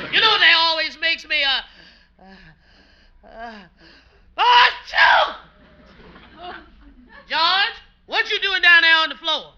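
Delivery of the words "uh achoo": explosive sneeze after a build-up; big sneeze. Acted. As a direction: let the sneeze burst out high. Rose fever, mother-in-law sneezes.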